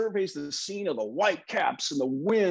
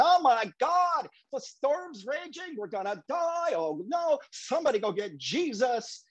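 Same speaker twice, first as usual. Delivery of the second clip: high, mock-frantic cry of doom; panicked disciples; 7 semitones higher than usual. Minister voicing panicked disciples in the storm, high mock panic. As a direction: play the frightened disciples in the storm. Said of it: "They're panicking. They're fearful. They're going".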